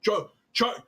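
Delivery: mock a clueless pundit; mock propagandist. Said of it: "Just being like".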